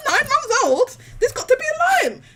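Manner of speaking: high, loud, laughing outrage; appalled protest. Retold or imitated. Arguing a line must be drawn, laughing high-pitched protest. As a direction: protest in disbelief, pitched right up.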